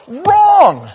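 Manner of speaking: loud, high shout; sudden booming correction; emphatic rebuttal. Real, not acted. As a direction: shout it out like a buzzer. Rebuts the mocked idea.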